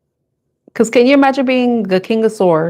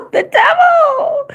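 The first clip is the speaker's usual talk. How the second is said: stretched out very high and slow, laughing; dramatic laughing reveal; much slower than usual. Real, not acted. Tarot reader to viewers, announcing the devil card with a high laugh. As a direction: drag the name out high through a laugh.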